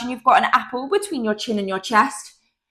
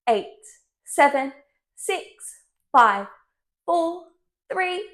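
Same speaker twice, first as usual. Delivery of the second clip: ordinary delivery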